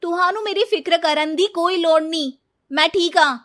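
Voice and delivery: loud, high brush-off; curt refusal. Acted. Bride rebuffs mother-in-law's concern, loud and curt. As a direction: snap her away, loud and cold. Snapping at kind mother-in-law.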